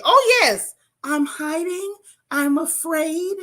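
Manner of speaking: high, slow mock-frightened caller; high slow fake-scared 911 call voice. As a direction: play the helpless victim on the phone. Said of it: "I'm switching to the voice".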